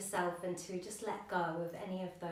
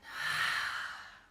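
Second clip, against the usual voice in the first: audible breathy exhale; long sigh out. Shown as a demonstration. Yoga teacher shows the class the exhalation, long sigh. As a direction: let a long breath go through the mouth. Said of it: "a long sigh out".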